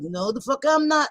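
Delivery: ordinary talk, nothing marked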